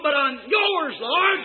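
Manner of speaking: high, exultant preacher's shout; crying out. Acted. Convert gives self to the Lord, crying out. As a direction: build to a high shout of surrender. Acted cry of surrender to God.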